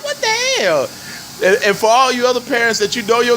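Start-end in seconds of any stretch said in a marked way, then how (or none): 0.0-0.9 very high incredulous cry; mock-shocked, high-pitched reaction; retold exasperation. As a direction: voice jumps way up, baffled.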